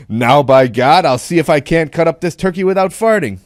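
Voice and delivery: ringing punchline announced to a whole room; loud and distinct. Acted. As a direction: declare it boldly, hitting every word. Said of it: "said loudly and distinctly".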